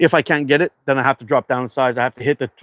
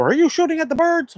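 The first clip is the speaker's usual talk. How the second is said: high mock yell from next door; retold yell. Streamer retelling a neighbour calling over, mock yell. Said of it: "somebody yell over".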